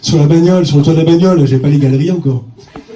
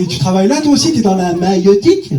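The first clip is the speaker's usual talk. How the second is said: ordinary delivery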